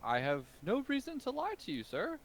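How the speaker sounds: put-on the old merchant feigning innocence to the ogre; flustered shopkeeper.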